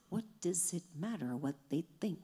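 sudden whisper. Acted. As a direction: drop to a whisper. Sudden drop after loud preaching.

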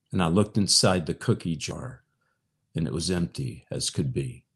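gentle recital of song lyrics; reading lyrics. Acted. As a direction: read the verse like a tender poem. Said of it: "to read the lyrics to you now".

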